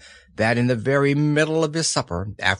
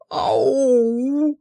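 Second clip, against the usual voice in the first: high sudden waking yelp; startled cry; 12 semitones higher than usual. A narrator's reading. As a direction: jolt awake with a cry. Pooh wakes cold and startled.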